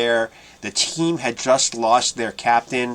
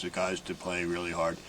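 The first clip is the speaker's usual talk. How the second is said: flat, sleepy, lifeless drone; monotone voice; 5 semitones lower, 12 dB quieter than usual. Retold or imitated. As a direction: say it like half asleep at a press conference. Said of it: "everything was like this".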